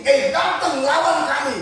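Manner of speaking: high, loud, slow taunting call; enemy challenge. Acted. Philistines dare the pair to fight, loud challenge. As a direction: shout a drawn-out challenge across the field. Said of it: "ngomongnya begini".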